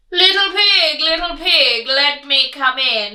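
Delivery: loud wolf voice calling at the door; loud sing-song wolf call. Acted. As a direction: coax the pig like a big bad wolf.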